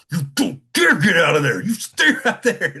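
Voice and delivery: loud, high mock scolding; bossy little lady laying down the law; hammed-up high-pitched telling-off; imitated verbal abuse. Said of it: "verbally abusing me".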